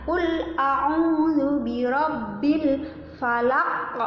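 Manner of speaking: slow, drawn-out tajwid chant; Quran recitation. Shown as a demonstration. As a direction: stretch each syllable in recitation.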